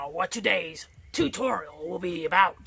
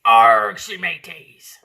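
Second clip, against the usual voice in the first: loud Arg then a slow mateys; pirate greeting; 8 dB louder, slower than usual. Acted. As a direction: growl it like a pirate. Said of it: "I'm going to switch to Missus Awan".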